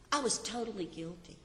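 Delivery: a sudden quiet admission; hushed confession. Real, not acted. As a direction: let it fall away quietly, almost to herself.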